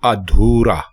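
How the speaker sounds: ادھورا as "a-dhoo-ra", open a; ادھورا with zabar on alif, not u; correct pronunciation. Shown as a demonstration. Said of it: "درست لفظ".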